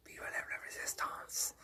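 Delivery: unvoiced conspiratorial whisper. Mock rebel whispering to comrades. Secret resistance whisper bit.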